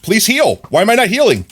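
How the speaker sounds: high, loud desperate plea; panicked cry. Real, not acted. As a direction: beg the game in panic. Panicking at low health.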